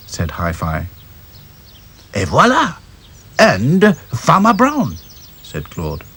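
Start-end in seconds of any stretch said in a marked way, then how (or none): 2.1-5.0 put-on high French-accented doll. French doll spotting farmer, flourish. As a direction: announce it with French flair.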